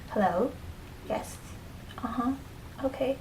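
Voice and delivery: hushed, discreet phone answers; short, softly spoken replies; talking really low. Shown as a demonstration. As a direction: keep it low and soft, as on a phone in public. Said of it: "talk really low".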